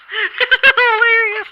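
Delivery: laughing hard mid-word; high-pitched burst of laughter. Real, not acted. Laughing at selfie stick.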